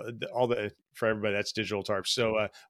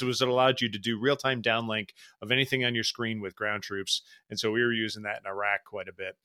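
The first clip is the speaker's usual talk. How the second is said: ordinary talk, nothing marked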